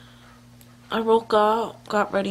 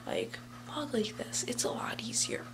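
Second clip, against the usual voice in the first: whisper; 4 semitones higher, 8 dB quieter than usual. Real, not acted. Showing how to vlog quietly.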